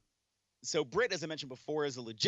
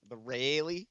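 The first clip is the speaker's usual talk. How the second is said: put-on copying upstate New York accent. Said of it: "I'm trying to copy your accent".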